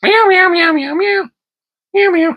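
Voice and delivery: very high, squeaky meowing at the cat; cat meows. Pretend. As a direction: meow like a kitten to tease him.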